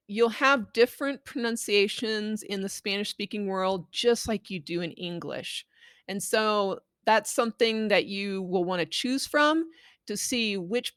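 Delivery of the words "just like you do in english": usual speaking voice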